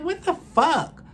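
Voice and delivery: high, slow, incredulous "what the fuck"; retold disbelief. Woman spotting the neighbour who banged on her door, shocked. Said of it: "And I'm like".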